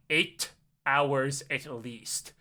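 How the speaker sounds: low, slow, deadpan guard; terse guard. Retold or imitated. Guard tells Jerry the flight length, terse guard. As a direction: drag it out in a low official voice.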